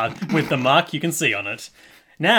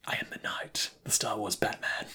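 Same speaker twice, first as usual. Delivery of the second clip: low gravelly whispered growl; Batman whisper; 5 semitones lower, 12 dB quieter than usual. Acted. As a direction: whisper it like a brooding caped hero. Dramatic Batman line.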